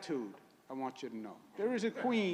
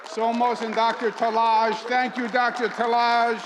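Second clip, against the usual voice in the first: raised, projected announcer voice; rally introductions; 5 semitones higher, 6 dB louder than usual. Real, not acted. Host introducing community guests to the crowd, rally announcer. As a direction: announce each guest to the crowd, building to a loud finish.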